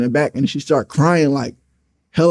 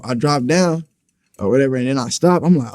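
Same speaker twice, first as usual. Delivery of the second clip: usual speaking voice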